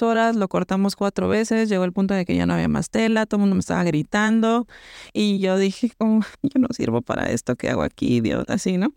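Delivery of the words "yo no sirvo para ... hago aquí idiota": low, dropping, self-pitying; mock despair. Telling a friend she gave up at work, low mock despair. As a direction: sink into hammed-up defeat. Breaking down after three hours.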